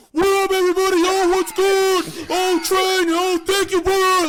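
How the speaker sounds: loud hype shout. Retold or imitated. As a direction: bellow the greeting like a hype streamer. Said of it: "I'd host Lakari, it's like".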